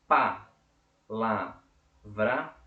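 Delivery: "pa, la, vra" as three loud, separate bursts; syllable by syllable. Shown as a demonstration. Teacher demonstrating three syllables, "pa, la, vra" split apart. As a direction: break "pa, la, vra" apart with a pause after each syllable. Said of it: "eu pronunciei, é, três emissões de voz".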